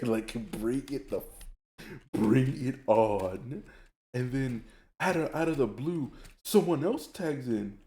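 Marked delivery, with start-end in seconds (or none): 0.5-3.6 slow, drawn-out dare; acted defiant challenge. Retelling a challenge to friends ganging up, drawn-out defiance. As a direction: stretch each word into a mock challenge.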